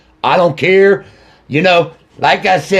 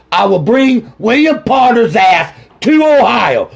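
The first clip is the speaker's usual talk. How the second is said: raised, furious vow; angry shout; 6 semitones higher than usual. Real, not acted. Grieving father shouting his vow to bring the killer back. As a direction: yell it like a promise of revenge.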